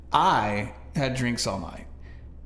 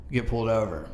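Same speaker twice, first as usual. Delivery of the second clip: ordinary talk, nothing marked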